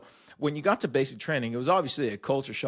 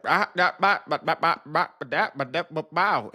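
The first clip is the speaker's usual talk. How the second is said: put-on Southern accent; 5 semitones higher, 4 dB louder than usual. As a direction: imitate a thick Southern accent as run-together nonsense syllables. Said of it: "some dude just like".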